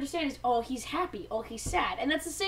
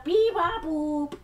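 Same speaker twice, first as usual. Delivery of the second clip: higher robot beeps; robot beeping; 3 semitones higher, 4 dB louder, much slower than usual. Retold or imitated. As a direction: beep and boop like a little robot. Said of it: "It goes basically exactly like that".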